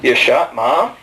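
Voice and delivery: very low voice of a hard-of-hearing dad; imitated father. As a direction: drop deep as the puzzled old father. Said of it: "This man looked at me and went".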